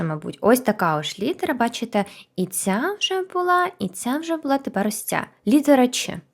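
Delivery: ordinary delivery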